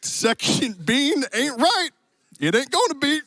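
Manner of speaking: put-on cowboy accent. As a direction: play a genial Western cowboy host. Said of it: "apparently some cowboy accent".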